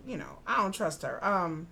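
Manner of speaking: ordinary talk, nothing marked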